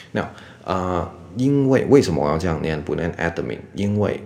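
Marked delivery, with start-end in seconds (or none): 3.1-3.6 “admin”念成ad-de-min; 在“admin”的d后插一个“的”; 多加母音的错误念法. Shown as a demonstration. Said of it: "D后面没有母音". (“admin”拆成三个音节)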